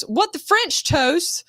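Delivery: 1 commercial line read. Shown as a demonstration. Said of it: "the first line in the commercial is".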